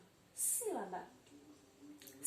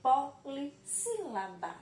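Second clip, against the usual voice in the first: polissílaba drawn out slowly and a bit louder; slow term demo; 3 semitones higher, 4 dB louder, slower than usual. Shown as a demonstration. Teacher to pupils, naming the term: slow, emphatic polissílaba. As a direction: stretch out polissílaba for the class. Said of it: "ela é chamada de".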